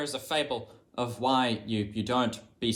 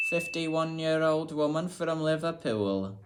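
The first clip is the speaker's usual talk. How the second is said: slow, hammed-up attempt at a Liverpool accent; bad Scouse accent; 6 semitones higher, slower than usual. Shown as a demonstration. Copying the Liverpool woman's clip, mock Scouse accent. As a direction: try out a Scouse accent and fail. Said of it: "I think that was terrible".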